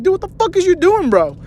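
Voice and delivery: very high, exasperated retold outburst; indignant protest. To the cousin who grabbed him, indignant. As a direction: protest in a high, incredulous pitch.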